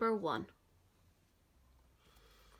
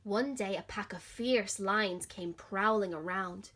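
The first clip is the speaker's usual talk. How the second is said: put-on gentle, expressive read-aloud for children; lively picture-book reading; story narration. A narrator's reading. Teacher reads story to children.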